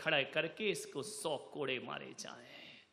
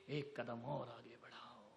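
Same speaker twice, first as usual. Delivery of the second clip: said much softer, low and intimate; soft urging; 7 semitones lower, 13 dB quieter than usual. Acted. Preacher urging listeners forward, softly. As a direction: coax the listeners gently. Exhortation repeated with contrast.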